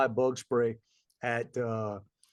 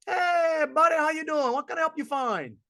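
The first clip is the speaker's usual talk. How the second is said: hearty mock greeting; 12 semitones higher than usual. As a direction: boom out a welcome like an overeager salesman. Store guys greeting him loudly.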